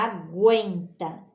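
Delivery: "aguenta" as a-gwen-ta; teacher's model "aguenta", u after g; audible U. Shown as a demonstration. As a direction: let the w be heard in "aguenta". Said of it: "ouve um pouquinho do som do U".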